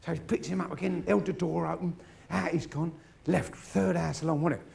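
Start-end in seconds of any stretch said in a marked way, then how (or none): none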